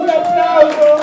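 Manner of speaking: loud, slow call for applause; shouted call. Acted. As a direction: rouse the crowd to clap. Calling for applause. (richiamo urlato all'applauso)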